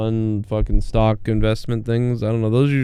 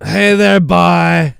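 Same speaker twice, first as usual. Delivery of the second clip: a light, higher, louder mock of the caller's sign-off; goofy caller voice; 5 semitones higher, 4 dB louder, much slower than usual. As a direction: toss off a dopey "bye" like a silly movie character. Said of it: "like a fucking Adam Sandler character".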